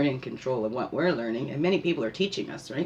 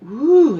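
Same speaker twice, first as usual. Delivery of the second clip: loud high woo; joyful whoop; 10 semitones higher, 12 dB louder than usual. Real, not acted. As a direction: whoop with joy. Joyful whoop about spirit.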